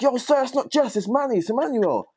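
high, loud protest. Retold or imitated. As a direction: insist that you're not the one he's after. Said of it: "and I'm like".

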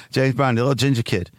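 ordinary delivery

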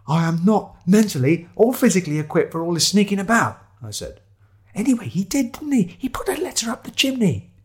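high-pitched, indignant complaint; flustered protester. Acted. Narrator to Shiloh about sneaking around, flustered. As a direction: protest in a high, put-upon voice.

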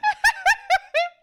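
loud squealing laugh; high shriek of laughter. Real, not acted. Laughing at her friend's outburst, high squealing laugh.

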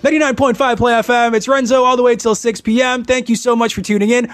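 bright-energy radio DJ patter, pitched high; energetic ad lib. Shown as a demonstration. DJ demonstrating an on-air ad lib, high and energetic. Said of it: "I can do an ad lib".

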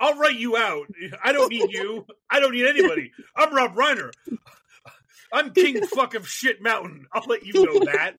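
imitated egomaniac outburst; mock tirade. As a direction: rant like a puffed-up boss in a pretend power trip. Imitating Rob crushing his co-host.

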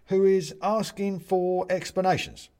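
high, loud address to the judge; little china man. Acted. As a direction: present a petitioner to the court. Bobs presents visitor to judge.

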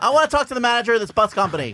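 fast, high-pitched complainer; mock customer outrage; entitled Karen. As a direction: rush out a hammed-up indignant demand. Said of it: "Oh, Karen".

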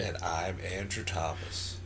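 low, soft self-introduction; very quiet. Real, not acted. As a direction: say your name far too softly. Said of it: "You're so quiet".

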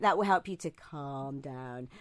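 imitating his calm host voice; soothing imitation. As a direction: mimic a gentle sleep-story voice. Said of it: "as you went".

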